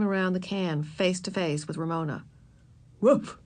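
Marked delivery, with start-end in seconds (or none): 3.0-3.4 short, sharp dog bark; dog's woof. A narrator's reading. Ribsy the dog to hidden Ramona, barking. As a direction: give a quick woof like a dog. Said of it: "said Ribsy".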